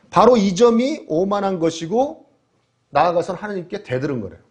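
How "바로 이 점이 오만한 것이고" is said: ordinary talk, nothing marked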